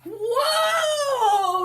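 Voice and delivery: high, loud startled cry; yelled. Acted. Pedro flying down the hill on his sled, loud yell. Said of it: "yelled Pedro".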